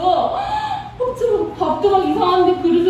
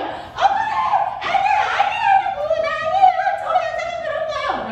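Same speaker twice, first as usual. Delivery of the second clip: wronged protest. Acted. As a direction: act out a falsely accused wail. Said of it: "요셉은 억울해". (아주 높은 목소리로 억울한 항변)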